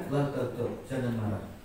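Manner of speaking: low, restrained reply; calm answer. Retold or imitated. Retold calm answer, third time. (menjawab tenang, rendah)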